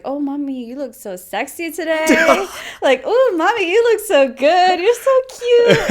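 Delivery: high, loud teasing sweet talk, slowing down; butter up grandma to make her laugh; playful flattery. Retold or imitated.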